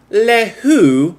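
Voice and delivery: high, loud mock-French line; comic French accent; French-sounding quote. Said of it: "No, that was cut. That was too French".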